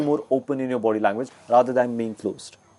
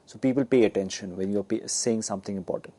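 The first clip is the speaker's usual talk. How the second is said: lower, softer voice; quiet and low, fading softer; volume low; 5 semitones lower, 7 dB quieter than usual. Shown as a demonstration. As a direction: drop the volume to draw listeners in. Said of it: "volume low, usually it means".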